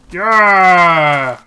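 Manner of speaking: loud happy cheer. Real, not acted. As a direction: celebrate out loud. Cat named and tamed.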